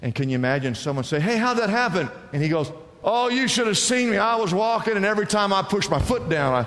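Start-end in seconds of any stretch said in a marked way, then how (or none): 1.2-2.1 high, eager acted asking; amazed question. Acts someone asking the soldier. 3.0-6.7 high-pitched bragging soldier act; mock boasting. Acts soldier boasting of his feat.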